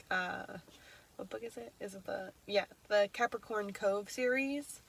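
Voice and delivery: usual speaking voice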